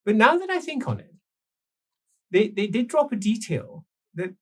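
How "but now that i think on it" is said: ordinary talk, nothing marked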